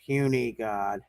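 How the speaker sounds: low, slow dismissive Hulk; Hulk's contempt. Acted. Game master voices Hulk looking down at a beaten foe, contemptuous. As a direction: drop low and draw it out with contempt. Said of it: "at Darren and goes".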